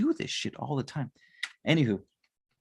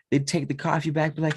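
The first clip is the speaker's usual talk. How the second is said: usual speaking voice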